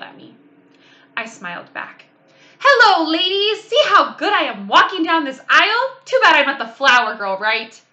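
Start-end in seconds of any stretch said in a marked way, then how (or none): 2.6-7.8 put-on high, loud little-girl call across the church; chirpy loud hello to the ladies. A narrator's reading. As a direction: greet them loudly, proud of herself. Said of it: "My voice sounded loud in the church".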